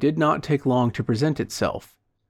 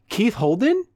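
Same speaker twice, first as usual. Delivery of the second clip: high, rising call, more sure of it; mildly confident, high-pitched hail; added assurance; 9 semitones higher than usual. A narrator's reading. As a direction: call out again with friendly assurance. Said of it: "reiterated with a tone of added assurance".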